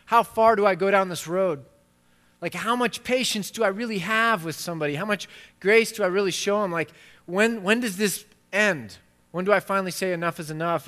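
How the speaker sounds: a fed-up disciple pleading for a limit; exasperated Peter. Retold or imitated. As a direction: push the questions up like you've had enough. Peter voiced pleading with Jesus.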